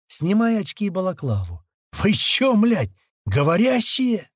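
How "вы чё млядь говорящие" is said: high-pitched, incredulous question; blurted astonishment. A narrator's reading. Man asking monkeys if they talk, astonished. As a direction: pitch it up in disbelief. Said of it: "выпалил Слива".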